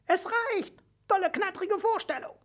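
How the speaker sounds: very high squeaky praise; tiny mouse voice. A narrator's reading. Mouse referee ends and praises the hedgehog's turn, squeaky. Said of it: "kam es aus Mausels kleinem Schnäuzchen".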